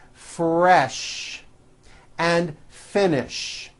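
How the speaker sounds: slow, careful model words for repeating; pronunciation teacher modelling; drill words. Shown as a demonstration. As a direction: say each word clearly for the class. Pronunciation drill word list.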